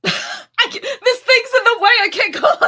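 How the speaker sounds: real giggle fit; laughing. Real, not acted. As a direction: crack up mid-sentence. Laughing, can't do the voice.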